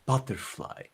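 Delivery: soft, gentle-volume butterfly; soft, not angry. Shown as a demonstration. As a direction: say the word quietly and easily. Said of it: "You can say it like this".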